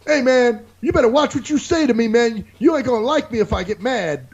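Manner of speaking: high, pushed imitation of a warning; imitating an indignant hothead; mock threatening guy. Retold threat in an argument.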